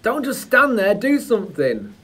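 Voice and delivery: panicked plea. Acted. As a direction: beg for help during a seizure. Acted seizure scene.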